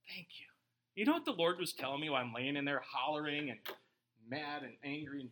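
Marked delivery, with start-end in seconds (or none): 0.1-0.5 much softer, intimate; soft thanks. Retold or imitated. Patient thanking God from hospital bed, soft. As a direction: barely say it. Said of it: "I was like".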